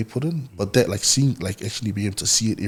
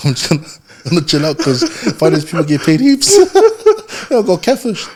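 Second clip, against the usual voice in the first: laughing; 8 semitones higher, 12 dB louder than usual. Real, not acted. Laughing at his own story.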